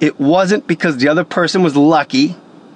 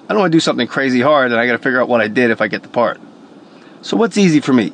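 usual speaking voice

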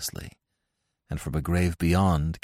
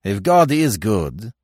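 loud, slow, bitter challenge; harsh cry; 4 semitones higher, 8 dB louder, slower than usual. A narrator's reading. Dead man challenges God from his coffin, crying harshly. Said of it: "cried Francois harshly".

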